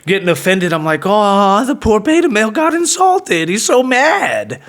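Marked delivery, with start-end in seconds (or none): 1.0-4.6 high, drawn-out taunting pity; mock sympathy. Man taunting offended rival, mock sympathy. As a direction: coo it like teasing a sulky child.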